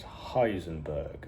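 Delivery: low, soft and slow, every syllable weighed; cold, slow alias. Retold or imitated. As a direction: say the name like a quiet threat. Walt giving alias to Tuco.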